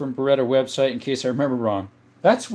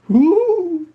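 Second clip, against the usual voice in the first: impressed ooh; 6 dB louder than usual. Real, not acted. Reacting to chat message.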